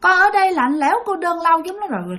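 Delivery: put-on a lonely lost child lamenting; weeping child ghost. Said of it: "ngồi đó khóc một hồi". (giọng con nít khóc than, cao)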